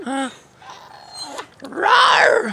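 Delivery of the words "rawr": high silly mock monster roar; playful roar.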